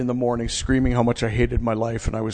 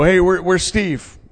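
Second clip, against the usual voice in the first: loud, raised-pitch asking around; slow, loud, higher searching question; calling out; 6 semitones higher, 6 dB louder than usual. Retold or imitated. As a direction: imitate a friend calling across the house. Friends asking around for him.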